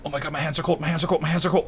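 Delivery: high, mock-frantic repeating; imitating panicked obsessing. Past self in the ice bath, mock panic. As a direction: ham up the fretting in the cold plunge. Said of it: "I'd be obsessing".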